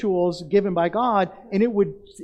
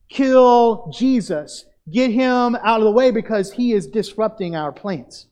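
loud, slow, higher crowd yell; angry mob demand; 3 semitones higher, 5 dB louder than usual. Retold or imitated.

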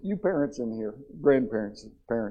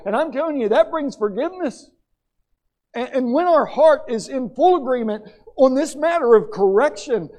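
higher and louder, driving sermon delivery; fiery preaching; 7 semitones higher, 7 dB louder than usual. Acted. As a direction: preach it with full fire. Preaching at full force.

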